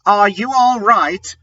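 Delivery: high anxious calling; worried. Acted. Draco checks on Ebony in a high worried voice. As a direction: ask after her, worried and up high. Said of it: "in a worried voice".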